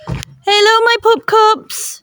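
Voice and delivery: sing-song hello to the puppies; doting dog-owner voice at the door. Real, not acted. Greeting her puppies at home.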